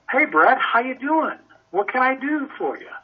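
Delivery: bubbly friendly client. Retold or imitated. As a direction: greet him like an old friend, thrilled. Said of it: "I've got an I".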